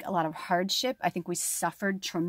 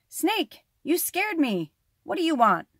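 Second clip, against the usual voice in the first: high, startled lizard voice; startled cry; 9 semitones higher than usual. Acted. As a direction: jump at the snake's surprise. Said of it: "lizard cried".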